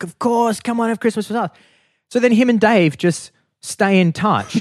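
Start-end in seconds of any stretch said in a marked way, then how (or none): 0.0-1.5 high-pitched, eager invitation; imitated welcoming. Relatives inviting the stranger to Christmas, high welcoming tone. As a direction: welcome him in with a mock family chorus. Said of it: "welcoming him".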